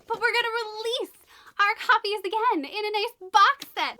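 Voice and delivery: high, loud mock announcement; announcing. Publisher announces a box set, in a high mock announcement. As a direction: chirp it like a cheerful publisher.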